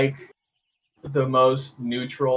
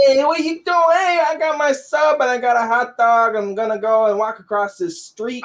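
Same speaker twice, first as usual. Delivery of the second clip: loud, high New York street rant; loud New York tough-guy tirade; New York accent; 8 semitones higher, 4 dB louder than usual. Retold or imitated. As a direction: rant like a New Yorker dodging a taxi. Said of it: "your New Yorkin accent".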